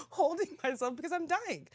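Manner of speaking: high, strained-up mock desperation; acting out dying. Contestant reenacting the gas test, mock desperate. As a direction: reenact the panic, pitch jumping up. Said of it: "I'm like this".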